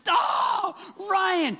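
high-pitched groan of a missed chance; exasperated, not angry. Retold or imitated. Holy Spirit reacting to preacher's missed chance, good-natured dismay. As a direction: groan the name in playful letdown. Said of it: "I don't feel like he's so mad".

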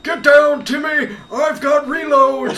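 high, loud battlefield yelling; cover me shout. Pretend. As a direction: bark orders like a frantic soldier. Old gamer barking orders in-game.

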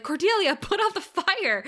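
high-pitched urgent order; Buffy, urgent. Acted. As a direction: call out an exasperated command. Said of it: "and Buffy's like".

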